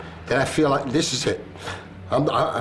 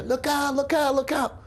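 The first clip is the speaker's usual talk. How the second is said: retold warning; 11 semitones higher than usual. Someone warning the crowd, high urgent cry. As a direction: imitate someone crying a warning as the tower falls. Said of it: "all of a sudden we hear".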